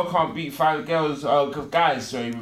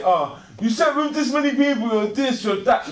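high, loud mocking scold; mock a preachy judge; judgmental religious person; 8 semitones higher, 4 dB louder than usual. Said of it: "religious people will say".

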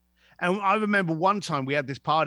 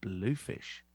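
low, hushed password at the door; murmured password; 10 semitones lower, 11 dB quieter than usual. Retold or imitated. Guest leaning in to the doorman, hushed and low. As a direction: lean in and murmur it softly.

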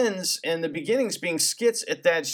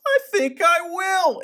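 much higher, slow, coy answer; squeaky reply; 12 semitones higher, 4 dB louder, slower than usual. Retold or imitated. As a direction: say it high and slow like Pee-wee. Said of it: "And he goes".

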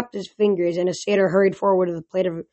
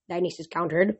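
usual speaking voice

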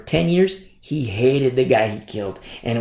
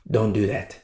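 normal talking voice

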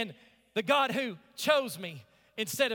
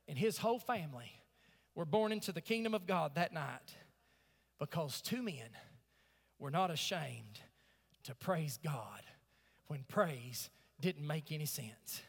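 hushed preaching; 11 semitones lower, 10 dB quieter than usual. Real, not acted. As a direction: drop the voice low and soft, slowing at the end.

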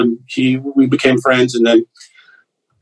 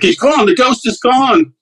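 hammed-up drama; 13 semitones higher than usual. Roommate announcing the ghost has left, hammed-up drama. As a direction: play it theatrically, voice pitched well up.

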